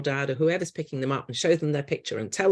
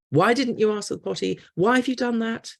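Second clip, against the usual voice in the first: exasperated scolding; 7 semitones higher than usual. Retold or imitated. Adults scolding wet child.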